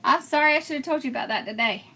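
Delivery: ordinary delivery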